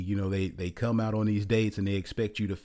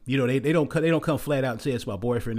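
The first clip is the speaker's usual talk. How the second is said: usual speaking voice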